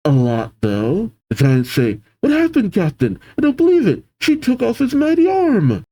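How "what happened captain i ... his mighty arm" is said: high, distressed disbelief; dismayed outcry. Acted. Giants to their maimed captain, acted high-pitched dismay. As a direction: voice the shock up high, hammed-up.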